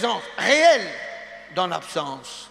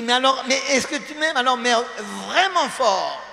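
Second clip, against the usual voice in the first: higher, pressing wife's voice; insistent wife; 4 semitones higher than usual. Retold or imitated. Wife pressing husband to love her strongly, higher insistent voice. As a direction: push for a bigger yes, a touch higher. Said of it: "Et quand elle dit".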